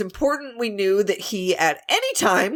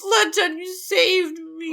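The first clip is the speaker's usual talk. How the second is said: swooning gratitude; 9 semitones higher, slower than usual. Acted. Playing rescued patient.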